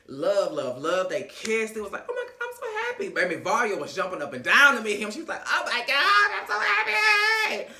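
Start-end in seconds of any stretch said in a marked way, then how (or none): 2.1-3.1 very high, quick squeal; tiny high-pitched excited voice; mock delight. Imitating a delighted greeting. 5.5-7.7 loud, very high, drawn-out wail; mock overjoyed squeal. Imitating Varya's overjoyed greeting.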